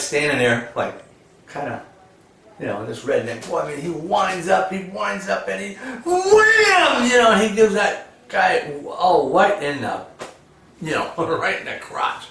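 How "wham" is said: long, loud, high-pitched wham; imitated impact. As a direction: stretch it out like a huge blow landing. Acts out the redneck's kick.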